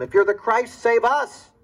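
high, loud, bitter demand; a dying thief railing at Jesus. Acted. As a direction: snarl the challenge from the cross. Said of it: "One of them railed at him".

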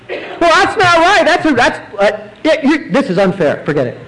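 high, protesting voice of an offended doubter; indignant doubter. Retold or imitated. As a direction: throw it back at God as if it were unfair, half laughing. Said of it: "how many of us, I think, would react".

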